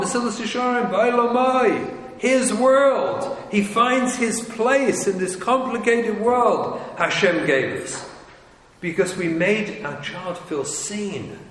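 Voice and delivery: ordinary talk, nothing marked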